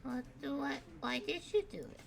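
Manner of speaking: put-on a lost kid looking around; small confused child calling out; Rad the kid. GM voices Rad.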